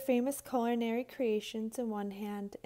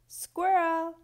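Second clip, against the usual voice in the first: loud, higher call up to a nest; 6 semitones higher, 6 dB louder than usual. A narrator's reading. Porcupine calling up to Squirrel's oak tree, loud call. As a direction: call her name up the tree. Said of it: "Porcupine called".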